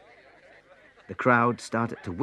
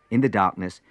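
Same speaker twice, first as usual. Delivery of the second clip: ordinary delivery, nothing marked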